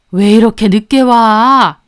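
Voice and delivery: loud, drawn-out reproach; scolding. A narrator's reading. Mother-in-law to the late youngest couple, scolding. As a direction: scold latecomers loudly and slowly.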